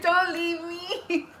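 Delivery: high, very slow mock whimper; worried pleading. Officer replaying her plea to the sheriff, mock worried. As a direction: plead comically, dragging each word out. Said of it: "this worried face on me".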